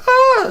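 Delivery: short high shriek; mock scream. The woman fleeing the attacker, mock scream. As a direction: let out a quick shriek.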